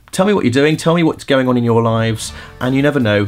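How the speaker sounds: usual speaking voice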